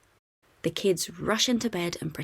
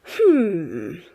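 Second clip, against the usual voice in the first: thinking hum; 8 dB louder than usual. A narrator's reading. Bluey thinks.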